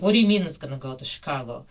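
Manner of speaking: loud, fast, disbelieving question; retold indignant protest. Passenger challenges staff about bag sent to Chicago, retold indignation. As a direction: fire it back as a retold objection. Arguing over the lost bag.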